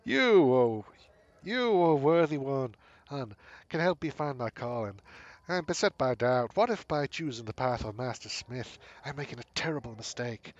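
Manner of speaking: theatrical far-travelled seer, new voice; the Tulip asks the worthy one for a writer; changed voice for the Tulip. Acted. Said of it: "I'm gonna have to change this voice".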